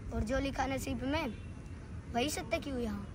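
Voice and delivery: soft verse in two halves; hushed recital. Acted. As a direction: recite it quietly with a pause midway. Soft first take of verse. (धीमे स्वर में, रुककर पाठ)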